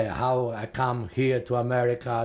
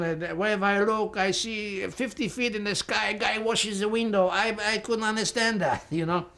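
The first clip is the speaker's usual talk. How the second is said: higher voice, full of happy amazement, close to tears; overjoyed wonder; 6 semitones higher than usual. Retold or imitated. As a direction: relive the thrill of seeing New York for the first time. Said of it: "I start to cry from happiness".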